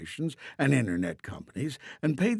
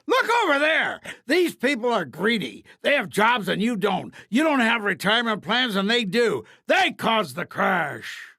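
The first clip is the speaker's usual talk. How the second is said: higher, louder accusing shout; blaming rant; 6 semitones higher, 3 dB louder than usual. A narrator's reading. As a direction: whip up anger at public workers. Politicians blaming public workers.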